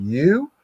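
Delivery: YOU swooping sharply upward; emphatic YOU. Shown as a demonstration. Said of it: "when you emphasize like you".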